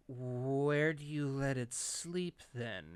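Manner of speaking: slow, drawn-out question; wary vet. Retold or imitated. Vet questioning the snake's owner, slowly and warily. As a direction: stretch it out as a suspicious vet would.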